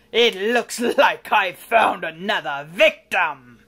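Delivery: booming slow villain voice; horrible villain. Acted. Villain finding another victim, gloating. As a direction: proclaim the catch, loud and slow. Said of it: "cried the horrible Heap".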